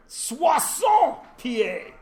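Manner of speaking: high, very slow hammed-up French; mock French. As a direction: proclaim it in bad French. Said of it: "except this is all in French".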